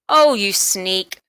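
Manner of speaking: ordinary delivery, nothing marked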